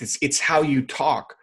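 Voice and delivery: ordinary delivery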